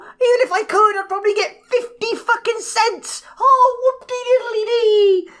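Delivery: sneering parody of forced hype; mocking whoop-de-doo. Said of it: "he just kept saying".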